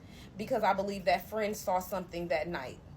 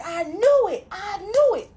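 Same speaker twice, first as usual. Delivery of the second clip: loud, high outburst; retold exclamation; 11 semitones higher, 10 dB louder, slower than usual. Prophet mother exclaims on phone.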